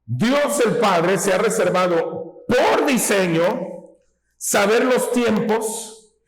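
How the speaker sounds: ordinary delivery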